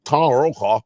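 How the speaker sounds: lightly gargled newsman voice; a hint of an anchor gargling his words; Tom Brokaw impression. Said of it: "He's gargling his mouth".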